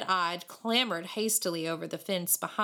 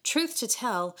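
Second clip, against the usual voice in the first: ordinary delivery, nothing marked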